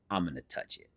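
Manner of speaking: barely voiced, eyeing the hamster; hushed to himself. Friend retelling reaching for the hamster, hushed.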